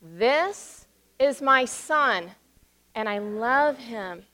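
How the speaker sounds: put-on very slow, each word spaced so children can follow; slow call and response. Shown as a demonstration. Pastor leads the children in repeating, slow and spaced out. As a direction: lead the children through it word by word. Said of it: "now say that with me".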